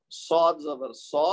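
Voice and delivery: صاد زبر سو slow, with a dark heavy s; heavy saad. Shown as a demonstration. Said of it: "صاد پڑھنا ہے".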